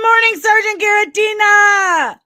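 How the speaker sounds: loud, high, shouted hello; shouted greeting. Real, not acted. Vlogger shouting good morning at his chat, loud and high. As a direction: belt the greeting out across the room.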